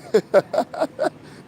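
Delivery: loud, high burst of laughter; amused laughter. Real, not acted. Laughing at the accent in the clip, high loud cackle. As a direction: crack up loudly at a high pitch.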